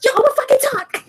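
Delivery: high, fast angry retort; mock screaming. Player as Karina insisting to her fleeing attacker, mock screaming. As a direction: fire it back fast in a high voice. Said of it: "She's literally screaming out".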